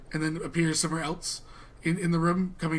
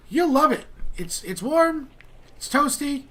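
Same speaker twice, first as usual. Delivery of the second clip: higher, louder, keen sales pitch; eager assurance; 9 semitones higher, 3 dB louder than usual. Acted. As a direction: sell it eagerly in a raised voice. Pitching hot plane, in character.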